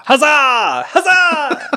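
high, laughing cheer; laughing huzzah cheer. Acted. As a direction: cheer it, cracking up.

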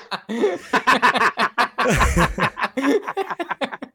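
high, rolling laughter; long helpless laugh. Real, not acted. Teased by co-hosts, laughing hard. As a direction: crack up at being teased. Breaks up after being teased.

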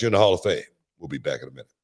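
normal talking voice

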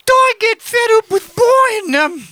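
high, slow, hammed-up Welsh lilt; mock Welsh accent. Friends tease him into reading the mum in Welsh accent. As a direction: ham up a Welsh mother's sing-song. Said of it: "you gotta do the accent".

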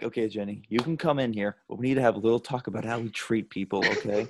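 soft, patient scolding as God; quietly talking down to Jenny; gentle God voice. Retold or imitated. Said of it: "God's just giving Jenny, like".

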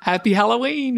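ordinary delivery

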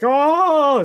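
retelling the actor's startled cry at the sudden drop; terrified gasp. Retold or imitated. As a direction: yelp like you're suddenly dropped. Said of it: "And he's like".

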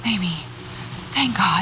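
whispered thank god; shaky whispered relief; relieved whisper. Acted. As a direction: breathe it out in relief. Relief at friend's return.

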